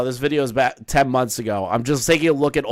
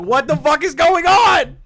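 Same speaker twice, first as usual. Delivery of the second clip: high, loud excited shout; animated, excited; 6 dB louder than usual. Retold or imitated. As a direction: re-enact an over-the-top thrilled outburst. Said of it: "so animated and excited".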